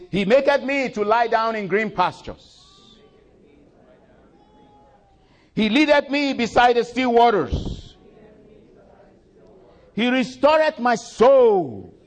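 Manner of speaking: high, loud, slow declamation; preaching a psalm. Acted. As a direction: proclaim the psalm over music.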